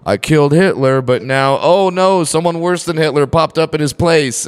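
high, loud boast tipping into alarmed realization; mock triumph, then dismay. Time traveler finding history got worse, hammed-up dismay. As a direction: play a pleased time traveler who suddenly panics.

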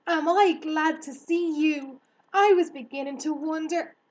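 high, loud, gushing relief; glad relief. Acted. Girl to the returned hare, gladly relieved. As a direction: greet a friend you feared lost.

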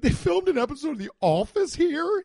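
the tourist, wowed by a TV connection; mock awe. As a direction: gush as if the sight were a miracle. Imagines himself awed by a trivial fact.

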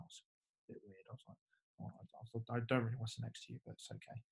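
hushed, muttering under the breath; muttered aside. Retold or imitated. Sitting by the coach at breakfast, muttering under the breath. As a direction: mumble it so the coach can't hear. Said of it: "and I was like".

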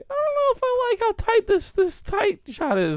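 high, put-on skeptical voice; mock doubt. Watching Hugh Jackman close-ups, high mock doubt. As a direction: squeak out your doubt about the shot. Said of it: "I was like".

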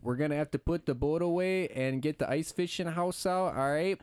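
put-on ice-fishing uncle in a thick Minnesota accent. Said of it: "I'm still working on my Minnesota accent".

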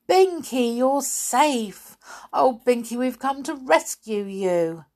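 high, excited relief; joyful reunion. Acted. Molly hugging Binky in glad relief. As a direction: gush with delight.

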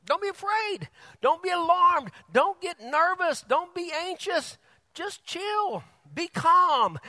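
high, urgent preaching; preaching at full force. Acted. Preacher urging the congregation not to fear, preached high and loud. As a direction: press the point hard, pitched high.

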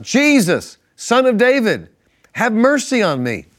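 high, loud cry of a blind beggar; shouting for mercy. A narrator's reading. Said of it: "he began to shout".

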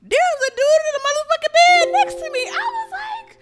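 loud, high-pitched reenacted shock; storyteller's hammed-up shriek of discovery; retold near-scream. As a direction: blurt it out as if about to scream. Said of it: "almost screamed".

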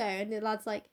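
usual speaking voice

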